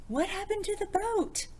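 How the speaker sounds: high, bright storytime question; excited question. A narrator's reading. The reader asking children what happened, in a high excited voice. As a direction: pitch it way up to thrill the kids.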